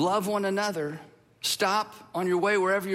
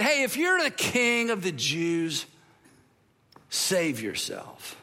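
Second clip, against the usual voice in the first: mocking jeer; slower than usual. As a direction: jeer like a mocking soldier, drawn out. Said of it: "came up and they mocked him".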